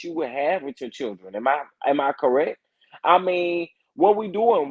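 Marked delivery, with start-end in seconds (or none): 3.9-4.7 high-pitched incredulous challenge. Real, not acted. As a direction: ask it high, in disbelief.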